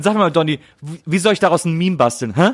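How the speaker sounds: mock demanding nag. S1 imitating demanding colleague.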